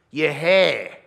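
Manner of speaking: put-on loud, accusing older bloke; older guy. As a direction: challenge him loudly about it. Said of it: "they'll say something like this".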